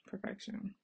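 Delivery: hushed repeat of perfection; soft echo. Real, not acted. Vlogger admiring her daughter's braids, soft echo. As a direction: breathe perfection in awe. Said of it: "repeated praise".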